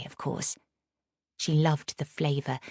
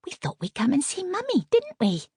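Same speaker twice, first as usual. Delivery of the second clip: much higher, lilting nurse voice; sing-song; 11 semitones higher than usual. Acted. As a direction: coo it like to a baby. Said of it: "said in a sing-song voice".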